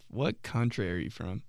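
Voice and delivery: ordinary talk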